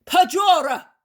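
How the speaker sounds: loud shout. Acted. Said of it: "raising his voice louder all the time".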